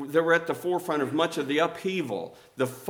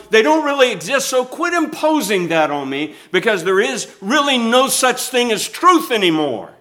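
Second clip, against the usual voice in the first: mock indignant protester; 7 semitones higher, 6 dB louder than usual. Preacher voicing protesters, raised.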